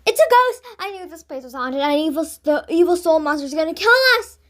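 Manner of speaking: high, loud terrified outburst; girl screaming that the ghost will kill them; panicked gasp. A narrator's reading. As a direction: blurt it out in terror. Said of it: "Jane gasped".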